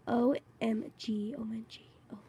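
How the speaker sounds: breathless hushed whisper; whispered OMG. Real, not acted. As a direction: whisper it in stunned disbelief.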